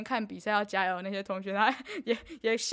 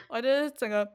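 usual speaking voice

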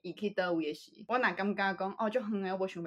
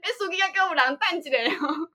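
raised voice, calling out to the front; loud call to the driver; 9 semitones higher, 5 dB louder than usual. Retold or imitated. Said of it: "我就会愈大声".

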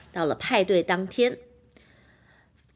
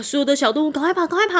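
usual speaking voice

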